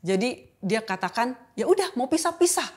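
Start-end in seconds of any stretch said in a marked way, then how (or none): 1.5-2.7 retold angry challenge. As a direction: throw back his angry "then split". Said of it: "keadaan kami kan lagi marah".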